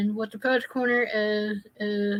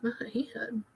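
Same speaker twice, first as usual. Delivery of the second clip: soft, voiceless joke under the breath; sly whispered punchline; whispered aside; 12 dB quieter than usual. Real, not acted. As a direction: slip the innuendo in as a whisper.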